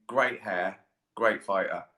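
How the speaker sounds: ordinary delivery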